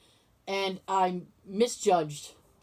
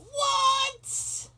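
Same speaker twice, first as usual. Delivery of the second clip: sudden high squeak of disbelief; shocked what. Retold or imitated. Retelling her reaction to the cancelled plans, shocked squeak. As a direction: pitch jumps way up in shock.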